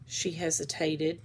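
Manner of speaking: plain reading voice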